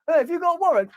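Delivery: high indignant squeak. Acted. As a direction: protest in a squeaky voice. Skit, journalist protests.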